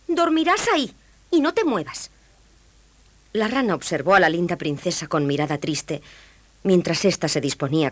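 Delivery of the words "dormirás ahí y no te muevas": high, commanding princess; bossy princess. A narrator's reading. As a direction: order the frog off in a high voice. (princesa mandona con voz aguda)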